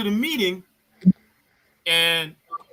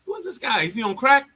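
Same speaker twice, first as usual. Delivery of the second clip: baffled disbelief; 2 semitones higher, 5 dB louder than usual. Retold or imitated. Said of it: "I was like".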